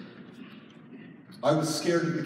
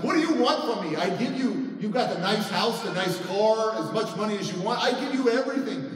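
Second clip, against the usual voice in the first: raised, higher exasperated protest; retold defensive outburst; exasperated arguing; 5 semitones higher, 3 dB louder than usual. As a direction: list it all off in frustration. Retold quarrel with wife.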